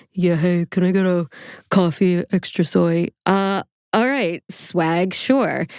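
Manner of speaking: low, too-casual customer imitation; mock laid-back voice, pitched slightly down; fake cool persona. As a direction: play it unimpressed while ordering coffee. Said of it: "he puts on a fake persona".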